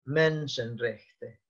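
"Menschenrechte" spelled out slowly in careful German, every syllable drawn out; German word spoken slowly. Shown as a demonstration. Lecturer shows students the German word, slow and careful. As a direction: give the class "Menschenrechte" slowly, in clear German. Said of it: "los derechos humanos se dicen".

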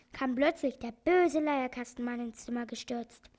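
ordinary delivery

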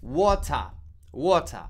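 water said the British way, crisp t, no final r; British English wota, wotcha, clipped and loud; British pronunciation. Shown as a demonstration. Profesora de inglés imita a un británico diciendo water, acento británico. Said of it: "Un británico dice".

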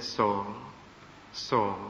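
low, solemn call of a name; a low, grave voice calling twice; voice of Jesus calling. Acted.

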